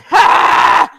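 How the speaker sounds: strained cry of frustration; frantic yell. Retold or imitated. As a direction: let out a desperate cry. Frantically typing the address.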